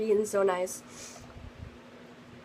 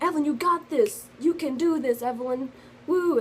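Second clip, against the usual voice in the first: rally her like an excited friend; cheering friends; 5 semitones higher than usual. Retold or imitated. Band friends cheering her on, high excited voices. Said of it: "so they was like".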